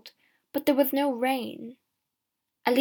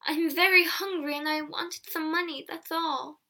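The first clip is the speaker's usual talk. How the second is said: higher-pitched pleading excuse; hasty excuse; 6 semitones higher than usual. A narrator's reading. Accused girl to villagers, excusing herself hastily. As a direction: explain yourself hurriedly, pitched up. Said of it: "Alison said quickly".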